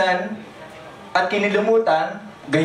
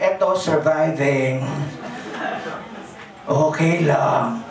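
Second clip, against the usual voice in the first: weary sigh; 8 semitones lower, slower than usual. Retold or imitated. Friend answers how he's doing.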